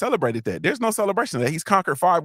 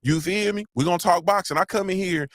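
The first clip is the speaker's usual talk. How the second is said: normal talking voice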